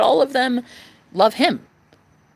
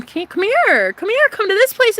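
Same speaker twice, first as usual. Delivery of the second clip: much higher, louder sing-song call; 13 semitones higher, 6 dB louder than usual. Acted. Calling her man to come to her place, loudly. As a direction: beckon him from far away.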